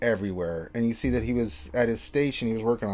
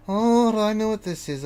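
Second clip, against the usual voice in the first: higher voice of recognition; raised-pitch daughter, catching on; gnomish daughter; 10 semitones higher, 3 dB louder than usual. Acted. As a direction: realise what it is, in character.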